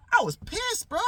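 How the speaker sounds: high, loud indignant outburst. Retold or imitated. Telling friends about his missing breakfast mango, loud and indignant. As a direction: let the anger burst out high.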